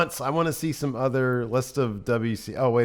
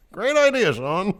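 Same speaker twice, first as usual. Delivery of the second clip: sarcastic; 9 semitones higher than usual. Retold or imitated. Sarcastic quote, laughing.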